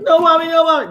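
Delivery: high, loud mock wail; fake-beg like a whipped kid; hammed-up child begging mommy; mock crying plea. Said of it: "cry and roll on the floor".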